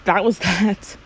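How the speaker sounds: usual speaking voice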